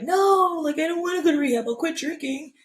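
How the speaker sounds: high, loud wail of refusal; mock whining protest. Refusing rehab to mom, acted whining protest.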